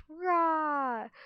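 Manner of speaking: high, loud, drawn-out mock roar; playful "raar"; pretend dragon roar. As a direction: roar like a small dragon playing fierce. Sunny fakes a queenly roar.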